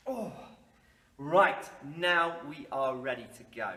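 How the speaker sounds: ordinary talk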